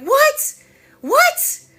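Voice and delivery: very high, loud shocked double take; stunned disbelief. Retold or imitated. Disbelieving self reacting to a rude manifestation, stunned. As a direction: squeak out a baffled what. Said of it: "the energy being like".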